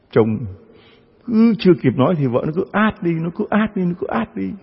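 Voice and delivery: normal talking voice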